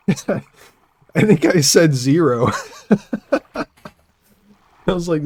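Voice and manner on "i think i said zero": laughing through the words; amused, breaking into laughter. Real, not acted. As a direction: say it while cracking up. Laughing recalling the call.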